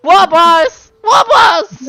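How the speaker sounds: very high, loud wailing shout; screeched, tattling appeal; calling for help. Acted. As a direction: cry out for the boss to intervene. Goblin appeals to boss.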